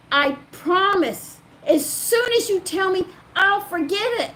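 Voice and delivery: high, loud, urgent vow; re-enacted insistent assurance; emphatic promise. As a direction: swear it to coax out the secret. Acts out man's earnest promise.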